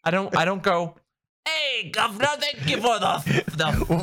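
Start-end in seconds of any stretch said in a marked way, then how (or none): 1.5-4.0 mock British gent, slow; posh-sounding British put-on; British accent. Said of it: "Why are you British now?".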